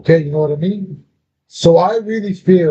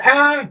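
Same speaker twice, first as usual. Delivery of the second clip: startled yelp; 10 semitones higher than usual. Retold or imitated. Scared by daughter in dream.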